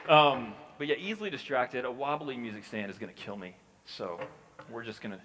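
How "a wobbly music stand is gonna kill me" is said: ordinary delivery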